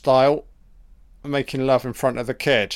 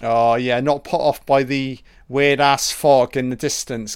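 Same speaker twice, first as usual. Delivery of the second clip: usual speaking voice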